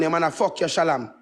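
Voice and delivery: ordinary talk, nothing marked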